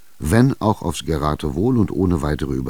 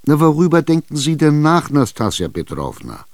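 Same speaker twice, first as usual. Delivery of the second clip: higher, louder, impatient buyer; Chichikov insisting; 8 semitones higher, 5 dB louder than usual. Acted. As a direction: corner her, push for an answer. Tschitschikow drängt. (Tschitschikow drängt ungeduldig, höher und lauter)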